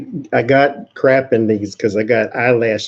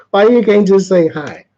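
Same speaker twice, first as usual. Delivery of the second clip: higher, louder, half-laughing plea; exasperated pleading; 7 semitones higher, 5 dB louder than usual. Real, not acted. Streamer begging silent viewers to say hi, exasperated and laughing. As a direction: throw up your hands at the chat, laughing.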